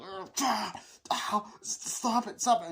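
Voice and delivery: high strained gasps and pleading; hammed-up stab victim; mock dying gasps. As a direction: gasp out the dying victim's pleas. Imitating victim being stabbed.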